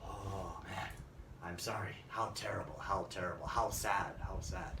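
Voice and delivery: low, soft commiseration; subdued, sorrowful murmur, acted; sympathetic. Shown as a demonstration. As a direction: offer hushed sympathy for bad news. Demonstrates reacting to bad news.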